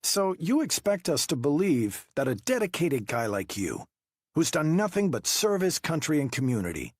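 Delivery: high, pressing investigator voice; interrogating detective. Acted. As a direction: push him with pointed questions. Wu pressing Trilling.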